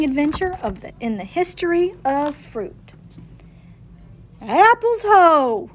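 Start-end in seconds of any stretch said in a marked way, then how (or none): 4.4-5.7 high, slow, ringing cry; triumphant drawn-out rallying cry; cried out. Acted. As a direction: call it out like a grand launch. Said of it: "he cried".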